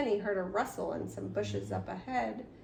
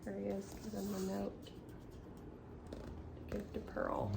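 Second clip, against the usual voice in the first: hushed aside; 10 dB quieter, faster than usual. Real, not acted. Quiet aside while reading.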